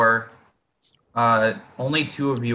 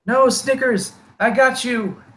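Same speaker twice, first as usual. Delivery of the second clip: very high, squeaky reassuring kobold; second kobold; 12 semitones higher than usual. Acted. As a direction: reassure a comrade in a high-pitched voice. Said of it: "second voice say".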